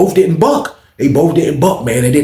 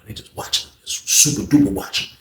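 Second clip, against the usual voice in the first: whisper; 3 semitones lower, 5 dB quieter than usual. Retold or imitated. Describing hidden watchers secretly.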